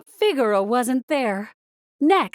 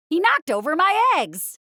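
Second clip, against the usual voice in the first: grocery truck driver; 7 semitones higher than usual. A narrator's reading. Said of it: "cried the driver".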